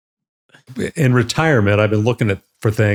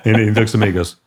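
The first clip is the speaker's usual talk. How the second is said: ordinary talk, nothing marked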